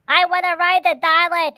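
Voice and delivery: put-on high, loud kid's shout; excited kid. Kids in the store beg loudly, high and eager. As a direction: squeal like an eager child. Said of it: "little kids ... going".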